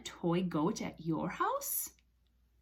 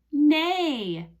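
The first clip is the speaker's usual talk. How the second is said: loud, higher, falling neigh; horse neigh; 5 semitones higher, 6 dB louder than usual. Shown as a demonstration. Teacher telling children what the horse says, neighing. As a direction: stretch out one neigh. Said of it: "The horse says".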